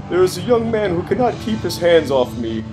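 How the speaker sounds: normal talking voice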